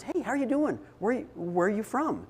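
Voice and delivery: imitating an outgoing friend, big and welcoming; cheerful greeting. Tim cheerfully greets a strange family.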